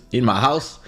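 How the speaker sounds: ordinary talk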